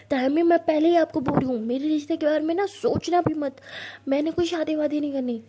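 loud firm refusal to marriage talk; loud protest. Acted. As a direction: put your foot down loudly.

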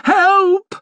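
high, loud cry for help; shriek. A narrator's reading. Maid cringing against the carriage door, shrieking. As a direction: scream out in terror. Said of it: "she shrieked, cringing against the door".

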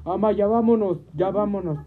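loud urgent plea. Retold or imitated. Daughter urging her mother to leave, loud. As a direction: hurry her out loudly. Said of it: "hablé así fuerte".

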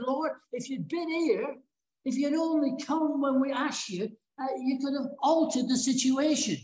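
high, earnest imitated plea; retold reproach. Mary to Jesus, word for word like Martha, high reproachful plea.

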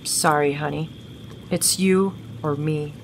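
low, subdued, detached goodbye; cold dismissal. Acted. Jasmine abandoning Gavin to the spider, coldly. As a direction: say it low and unmoved.

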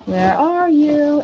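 high, loud, drawn-out call. Real, not acted. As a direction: call out slowly to the lost item. Searching for missing item.

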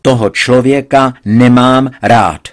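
loud and slowed declaration; father insisting to his daughter; emphatic repeat. A narrator's reading. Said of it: "ještě se mi ujistil".

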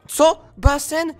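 surprised what. Acted. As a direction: jump at the news. Surprised by pool news.

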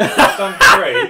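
laughing through the words; giggling as he says it. Real, not acted. Laughs at putting away three.

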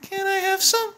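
high, loud pleading pet voice. Real, not acted. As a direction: beg the dog in a squeaky high coo. Begging the dog for peanut butter.

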